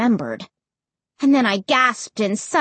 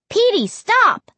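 high, slow shout at the dog; 12 semitones higher, 5 dB louder than usual. A narrator's reading. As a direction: yell it to make him stop. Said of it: "I shouted".

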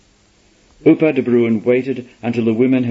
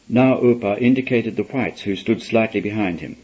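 plain reading voice

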